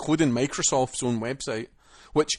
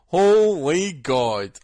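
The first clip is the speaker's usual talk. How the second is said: exasperated exclamation; 4 semitones higher, 7 dB louder, much slower than usual. Real, not acted. Exclaiming over pre-order ordeal.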